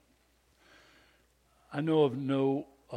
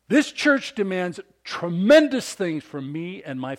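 slow, louder, hammering each word; mad dad; 5 semitones higher, 8 dB louder than usual. Retold or imitated. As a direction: make the man feel the weight. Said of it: "He was flippin' mad".

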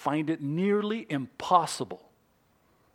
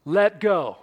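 firm instruction; 4 semitones higher, 8 dB louder than usual. Acted. God calmly gives the instruction.

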